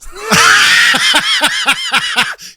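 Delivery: a big high shrieking laugh; loud burst of laughter. Real, not acted. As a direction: crack up loudly.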